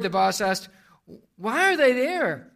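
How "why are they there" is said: high, loud, worried question; alarmed and worried. Acted. Worried boss questions the child on the phone, alarmed. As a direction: demand it, pitched up in alarm. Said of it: "more than a little worried".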